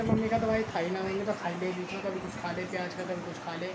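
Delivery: ordinary talk, nothing marked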